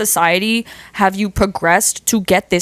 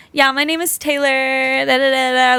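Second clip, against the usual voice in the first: put-on Valley girl; 5 semitones higher than usual. Showing how people expect her to talk, Valley girl. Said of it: "they think I'm gonna talk like a Valley girl".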